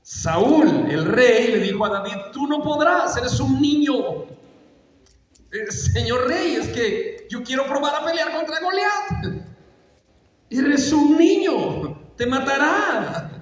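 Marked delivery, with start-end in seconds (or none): 2.3-4.2 higher-pitched, incredulous royal put-down; dismissive king. Acted. King Saul doubts young David can fight, dismissive. 5.5-9.5 put-on higher, hesitant little-boy voice; pleading boy. Young David asks the king to let him fight, pleading. 10.5-13.3 high, slow, stretched-out protest; incredulous warning. Acted. King Saul warns young David about Goliath, incredulous.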